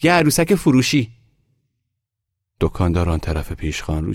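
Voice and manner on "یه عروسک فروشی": high, loud hawker's shout; shouted sales call. A narrator's reading. Boy pushes open a grocery door, shouting his offer. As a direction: shout it into the shop. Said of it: "داد زد".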